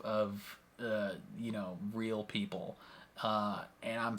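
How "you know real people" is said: ordinary delivery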